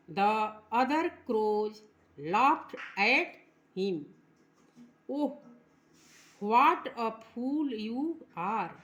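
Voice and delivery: slow, drawn-out recitation; slow poem reading. Shown as a demonstration. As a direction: read each word slowly for the class. (धीमा कविता पाठ)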